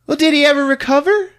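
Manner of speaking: high, loud, eager question; hammed-up worried kid voice; spooked kid. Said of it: "the kids are spooked".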